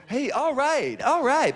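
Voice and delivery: high, whooping approval; imitated cheering crowd. Bar crowd cheering a nodded-out jazz combo, high imitated whoops. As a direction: cheer high, like an audience grooving along. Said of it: "everybody else was just going".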